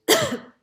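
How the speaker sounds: sharp loud cough, throat caught; real coughing fit. Real, not acted. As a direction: cough hard and suddenly. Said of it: "I got something in my throat".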